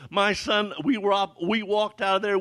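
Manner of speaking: normal talking voice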